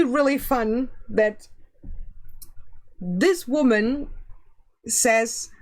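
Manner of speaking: ordinary talk, nothing marked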